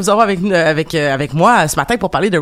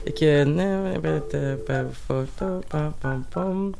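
muttering; 2 semitones lower, 8 dB quieter than usual. Real, not acted. Host dialing the phone under her breath.

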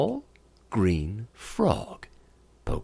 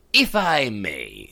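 slow frog; 3 dB louder, slower than usual. Acted. Frog speaks up slowly.

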